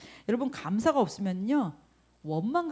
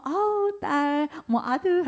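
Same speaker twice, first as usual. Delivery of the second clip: much higher, doting mother voice; gushing mom; 8 semitones higher, slower than usual. Retold or imitated. Mother cooing over her kids, high and doting. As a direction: coo like a delighted mom.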